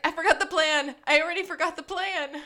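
loud, high-pitched mock panic; hammed-up frantic confession; Gus. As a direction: panic out loud, pitch up, as if it all just fell apart. Acting out Gus panicking over forgotten plan.

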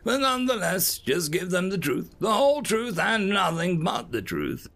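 high, emphatic courtroom-style phrasing; insistent observer. Acted. Observer instructing his assistant with a quote, insistent. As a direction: build the oath grandly, slowing at the end.

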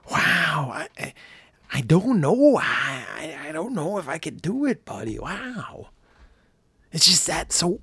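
a hoarse, half-voiced Owen Wilson musing; Owen Wilson impression. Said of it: "That's my Owen Wilson".

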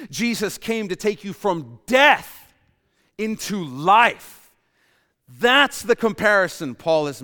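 loud, high, emphatic sermon climax; full-force preaching. Real, not acted. Preacher tells congregation Jesus brought them from death to life, full-force preaching. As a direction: drive each contrast home to the congregation.

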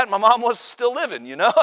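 ordinary talk

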